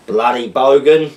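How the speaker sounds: slow, loud Australian accent attempt. Reading viewer slang aloud, slow Australian accent. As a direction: lean on bloody bogan, Aussie accent. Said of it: "decipher these Australian slang terms slash words in an accent".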